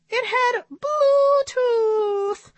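very high, slow, drawn-out punchline; squeaky monkey milking a joke; monkey puppet. A narrator's reading.

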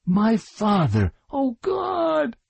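slow, very high cry of anguish; horrified cry. A narrator's reading. Girl sees her father attacked, crying out in horror.

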